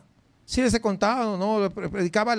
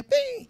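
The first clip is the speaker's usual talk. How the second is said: recorder ping. Retold or imitated. Imitating tape recorder start.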